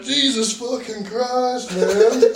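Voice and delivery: high, slow, loud exclamation; exasperated outburst. Retold or imitated. Driver retelling being pulled over, exasperated. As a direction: wail it in comic disbelief.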